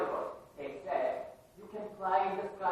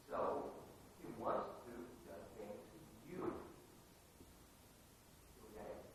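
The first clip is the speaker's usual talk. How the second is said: usual speaking voice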